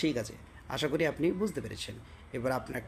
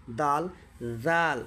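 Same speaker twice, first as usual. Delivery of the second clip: normal talking voice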